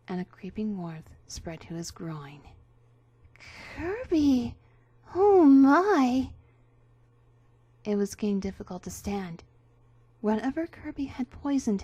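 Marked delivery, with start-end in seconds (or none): 3.7-6.3 loud, overwrought gasp of shock; hammy stage actor. Acted. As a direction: ham up the swoon. Poison takes hold.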